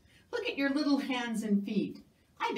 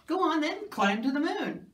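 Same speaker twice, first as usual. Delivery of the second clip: teasing dare; 5 semitones higher, 3 dB louder than usual. Acted. Narrator daring the little mouse, teasing and loud. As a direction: go on then, dare him, a bit louder.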